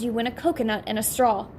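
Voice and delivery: ordinary delivery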